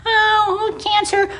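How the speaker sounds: high, put-on mock voice on "Oh, cancer"; mocking friends. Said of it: "all your friends who say that".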